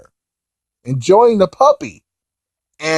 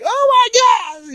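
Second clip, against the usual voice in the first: very high, drawn-out wail; mock panic; slower than usual. Boo learning the puppy won't run away, mock panic. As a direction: squeal it in hammed-up alarm.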